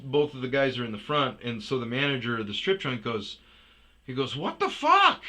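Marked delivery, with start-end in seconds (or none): 4.4-5.2 high, loud, incredulous question; startled outburst. Retold or imitated. Strip joint manager seeing the scene, loud disbelief. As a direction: exclaim it in disbelief.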